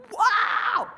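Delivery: a long, high, loud stretched wow; excited wow. Real, not acted. Telling the room about thirty percent growth, an amazed wow. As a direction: let the wow burst out slowly in amazement. Marvelling at explosive growth numbers.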